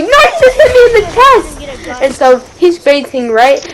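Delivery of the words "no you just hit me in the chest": put-on higher, whiny hurt-kid voice; imitating the hurt friend. Fifth grader quoting the friend he punched, high whine. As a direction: squeak it out like the kid who got punched. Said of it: "He was like".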